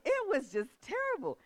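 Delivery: usual speaking voice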